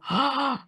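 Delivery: mock amazed gasp; awed gasp. Voice actor imitating parents watching her record, mock awed gasp. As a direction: gasp like an awestruck fan. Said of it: "They go".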